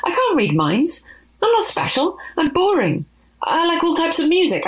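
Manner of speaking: high, flustered denial; flustered protest. Acted. Opie panicking, denying to the agents, high.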